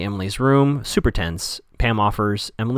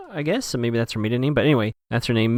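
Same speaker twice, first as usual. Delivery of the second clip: ordinary delivery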